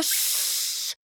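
soft hiss of boiling over; hissing porridge. A narrator's reading. Porridge hissing on the hot stove. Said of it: "а сама всё повторяет".

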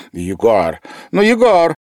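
громкий высокий умоляющий зов; упрашивай, окликая друга; умоляя. A narrator's reading. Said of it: "начал умолять медик". (медик громко умоляет Егора)

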